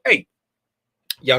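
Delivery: ordinary talk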